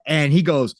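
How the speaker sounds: ordinary talk, nothing marked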